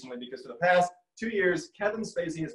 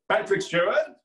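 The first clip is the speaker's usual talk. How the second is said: loud, raised theatrical actor voice; Patrick Stewart; 4 semitones higher, 6 dB louder than usual. Shown as a demonstration. Answering mom's last question, a loud actor impression. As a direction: declare the name grandly as the answer. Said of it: "to do a Patrick Stewart impression is to say".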